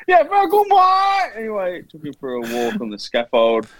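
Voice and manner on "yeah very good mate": much higher, loud shouted reply; hammed-up coworker yelling back happily; imitating coworker. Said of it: "And he's like".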